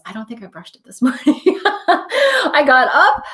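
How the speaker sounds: quick aside breaking into laughter; laughing. Real, not acted. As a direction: laugh at yourself as you talk. Laughs at own messy hair.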